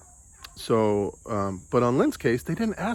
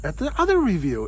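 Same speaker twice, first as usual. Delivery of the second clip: higher, disbelieving complaint; exasperated self-quote; 6 semitones higher than usual. Retold or imitated. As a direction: vent your disbelief. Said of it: "I said".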